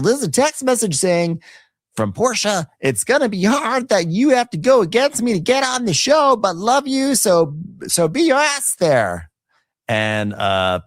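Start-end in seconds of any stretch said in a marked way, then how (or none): 0.0-9.3 high, campy read-out of a text; mocking Andy reading. Andy reading Portia's text aloud, high mock voice. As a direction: read the text in a squeaky host voice. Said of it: "he's like".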